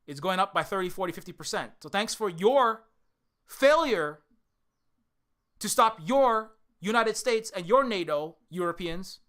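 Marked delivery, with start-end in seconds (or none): 1.8-8.4 bitter shout. Real, not acted. Sarcastic rant at Western viewers.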